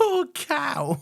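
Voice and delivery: very high, laughing squeal; squeaky, amused disbelief; incredulous echo. Real, not acted. Repeats phrase back in disbelief.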